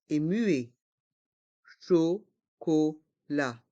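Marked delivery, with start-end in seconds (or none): 1.9-3.6 slow "chocolat" in three separate beats; learner-paced, split "chocolat"; syllable by syllable. Shown as a demonstration. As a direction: pull "chocolat" apart, cho, co, la. Teacher breaks word into syllables.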